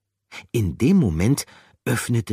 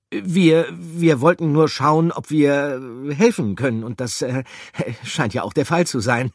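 babbling; 9 semitones higher, 8 dB louder than usual. Acted.

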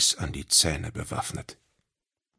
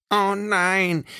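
high, louder wail of dismay; dismayed cry; 5 dB louder than usual. Acted. Little creature losing the dice roll, crying out. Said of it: "rief Eddie".

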